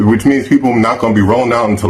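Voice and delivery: ordinary delivery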